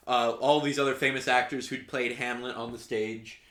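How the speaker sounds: ordinary talk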